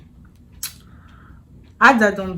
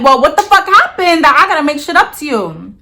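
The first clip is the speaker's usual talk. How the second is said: higher, louder retold challenge, rushed; indignant retort; 7 semitones higher, 6 dB louder, faster than usual. Woman retelling her reply to him, indignant. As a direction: throw the question back, loud and fast.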